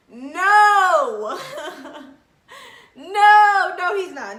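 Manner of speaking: loud, high crying "no"; mock outraged no. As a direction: wail it in disbelief. Disbelief at his good morning text.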